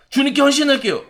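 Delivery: high-pitched acted pledge; fervent devotion. Believer promising devotion to the Lord, fervent high pledge. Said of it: "성령 충만해서".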